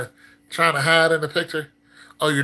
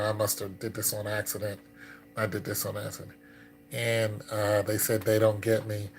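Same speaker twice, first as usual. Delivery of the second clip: ordinary talk, nothing marked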